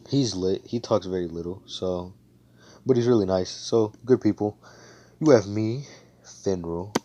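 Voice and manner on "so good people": ordinary delivery, nothing marked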